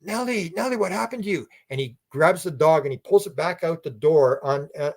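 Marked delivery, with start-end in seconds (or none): 0.0-1.5 alarmed calling. Retold or imitated. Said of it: "Chris is going".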